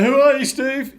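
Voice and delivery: high, loud and eager; eager question. Retold or imitated. Speaker asks the coach who he is, loud and eager. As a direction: ask it wide-eyed and keen. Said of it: "and I go".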